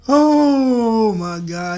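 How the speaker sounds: helpless laughter; laughing. Real, not acted. Laugh fit.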